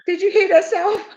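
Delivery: hammed-up incredulous self-talk; retold disbelief. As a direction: ask yourself in mock shock. Retells asking herself in shock.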